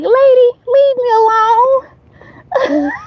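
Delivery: high, slow, whimpering little-animal voice; a tiny creature begging the lady to back off; bunny's plea. Retold or imitated. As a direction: voice the scared baby bunny, drawn out and squeaky. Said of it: "She like".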